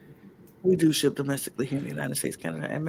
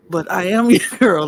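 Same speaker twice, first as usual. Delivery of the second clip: laughing; 4 semitones higher, 9 dB louder than usual. Real, not acted. Laughs through intro.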